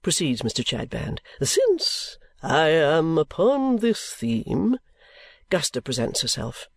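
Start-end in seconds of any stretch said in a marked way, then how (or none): 1.4-4.8 high, slow, unctuous sermonising; oily preacher voice, drawn out; pompous preacher. Acted. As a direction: play the greasy self-satisfied preacher. Chadband sermon.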